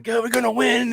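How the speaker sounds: high, strained mock yell; imitated scream. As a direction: imitate a player rallying the defense. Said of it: "he's screaming at the defense".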